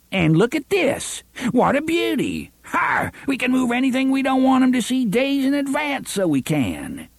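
high, gleeful, fast gloating; gleeful sheriff. Acted. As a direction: gloat over the paper like a happy sheriff. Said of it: "then cackled happily".

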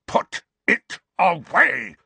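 high, loud, slow snarl in the ear. A narrator's reading. Uncle snarling at nephew to hide the wand. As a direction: snarl it at him through your teeth. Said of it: "Uncle Vernon snarled into Harry's ear".

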